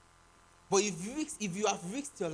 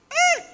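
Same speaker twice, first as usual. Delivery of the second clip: high shout; 12 dB louder than usual. Real, not acted. Calling for applause.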